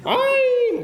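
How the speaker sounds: high yelp. Retold or imitated. Exclamation, high.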